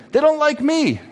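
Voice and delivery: high-pitched mock whine; complaining son. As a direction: whine an excuse like a reluctant kid. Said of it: "a mother goes to a son".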